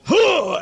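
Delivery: loud grunt. Retold or imitated. Cheer grunt after chant.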